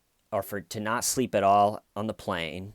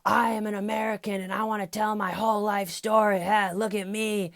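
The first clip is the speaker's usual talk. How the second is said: mock screaming; 12 semitones higher, 4 dB louder than usual. Retelling a restaurant stranger's outburst, mock screaming. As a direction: scream it in a high put-on voice. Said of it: "just starts screaming like".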